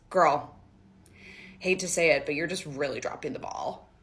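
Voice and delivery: put-on low, quiet, patronising older woman; condescending woman. The older woman scolds the narrator, low and condescending. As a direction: talk down to her like a smug elder. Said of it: "She goes".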